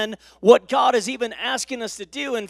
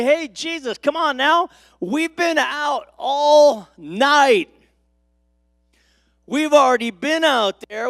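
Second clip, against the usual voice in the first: weary protest; 5 semitones higher than usual. Acted. Fishermen objecting to Jesus after fishing all night, weary protest. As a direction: plead that it's pointless, stretching the key words.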